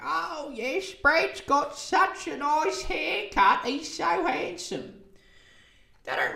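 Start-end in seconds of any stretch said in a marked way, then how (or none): 0.0-4.9 put-on high, slow, doting old lady; old grandma voice. Grandmas in Cronulla praising the minister's haircut, high doting gush. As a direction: gush like a smitten grandma, pitched way up. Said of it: "golden generation grandmas in Cronulla being like".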